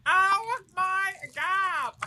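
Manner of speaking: very high, drawn-out sitcom catchphrase; Janice. Acted. Doing a Friends impression for co-hosts, high and drawn out. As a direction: stretch it out in an annoying high caricature. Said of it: "I do a Janice".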